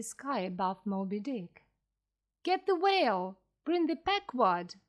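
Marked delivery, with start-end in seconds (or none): 2.4-4.8 higher-pitched, moderately loud command; shouted order. A narrator's reading. Captain in the sea ordering his ship over, shouting. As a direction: call out orders with raised pitch. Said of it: "shouted Captain".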